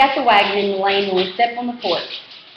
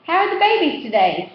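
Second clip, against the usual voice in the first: put-on high, boyish call down the lane; grubby kid calling; 9 semitones higher than usual. A narrator's reading. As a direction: call out cheekily to Beans. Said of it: "a grubby kid calls".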